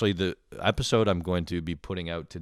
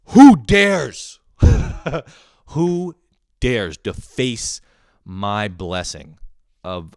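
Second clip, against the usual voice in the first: a brother raging over sliced donuts, hammed-up fury; mock rage; 3 semitones higher, 8 dB louder, slower than usual. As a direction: thunder like an offended god. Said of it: "I fly into a fucking rage".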